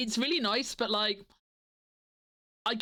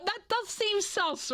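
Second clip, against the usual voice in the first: suspicious; 10 semitones higher, slower than usual. Acted. Mock suspicious drawl.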